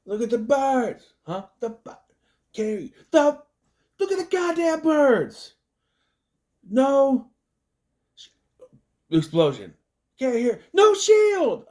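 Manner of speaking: very high, loud hammed-up yelling; a military guy yells at the dad over gunfire; shouting over explosions. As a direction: bellow over the blasts like a soldier.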